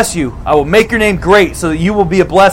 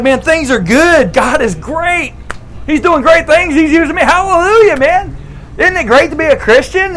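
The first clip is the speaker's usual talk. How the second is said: high, joyful praise voice; excited Abram; 7 semitones higher than usual. Acted. Abram thanking God, bursting with high excitement. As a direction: gush with happy praise to God. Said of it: "He's like".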